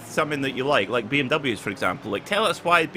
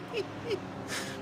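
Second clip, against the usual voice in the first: breathy high laugh; 14 dB quieter than usual. Real, not acted. Teammate ruining race.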